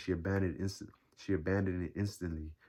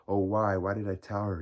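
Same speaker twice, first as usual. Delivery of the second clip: louder cry of despair; 3 semitones higher, 7 dB louder than usual. A narrator's reading. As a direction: exclaim in anguished regret. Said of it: "he exclaimed in despair".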